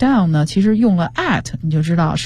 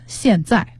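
ordinary talk, nothing marked

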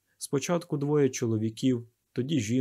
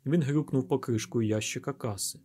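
ordinary delivery

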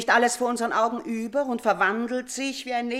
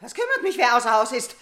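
a much higher, sharp cry; frightened outburst; 6 semitones higher, 6 dB louder than usual. Acted. The mother cries out at Elektra in fright. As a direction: snap back to hide the fear.

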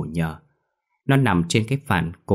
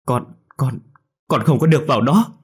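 higher, hurried tearful pleading; tearful aunt; 6 semitones higher, 5 dB louder than usual. A narrator's reading. Aunt restrains crying boy, tearful. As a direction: hold him back through tears. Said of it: "vừa khóc vừa nói".

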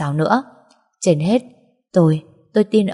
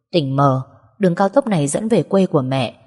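ordinary delivery, nothing marked

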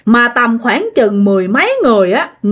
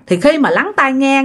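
ordinary delivery

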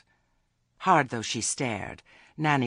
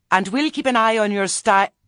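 loud; 12 semitones higher, 11 dB louder than usual. Acted. Cut off, staggers backwards.

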